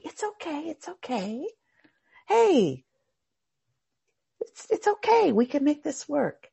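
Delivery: calm them down gently; soothing calm. Retold or imitated. You soothe the angry boaters, reassuring. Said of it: "you come running out on the water going".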